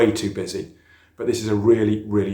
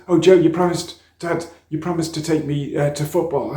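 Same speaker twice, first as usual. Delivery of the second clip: put-on higher, louder whiny kid; complaining child; 6 semitones higher, 6 dB louder than usual. Son reminding dad of the promised football, loud and whiny.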